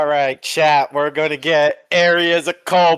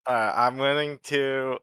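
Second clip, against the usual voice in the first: ordinary talk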